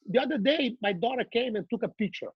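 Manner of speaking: usual speaking voice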